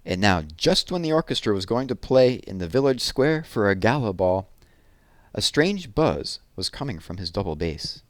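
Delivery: plain reading voice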